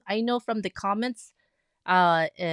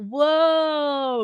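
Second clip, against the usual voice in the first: excited whoa; 6 semitones higher, 8 dB louder than usual. Real, not acted. Reacting to viewer's totality.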